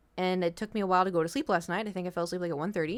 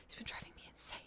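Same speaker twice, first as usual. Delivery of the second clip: whisper; 19 dB quieter, faster than usual. Real, not acted. Exasperated aside about cat.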